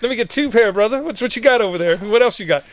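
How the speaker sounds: mock kid in a candy store; eager grab. Grabbing free gear, retold.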